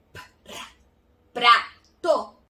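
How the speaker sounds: hushed "Pra", then loud split "prato"; P plus rolled R. Shown as a demonstration. Teacher blending the sounds for pupils, rolled R. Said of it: "E depois seguir com o rá".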